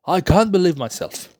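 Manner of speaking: usual speaking voice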